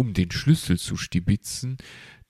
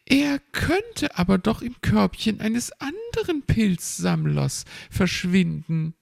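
chirpy magpie; 13 semitones higher than usual. Acted. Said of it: "erklärte sie kopfschüttelnd".

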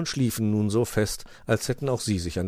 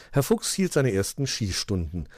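usual speaking voice

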